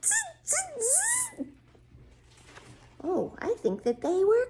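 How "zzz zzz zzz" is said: high, soft buzzing chatter; imitated monkey talk. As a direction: buzz like a storybook monkey. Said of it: "stomped their feet back at him and said".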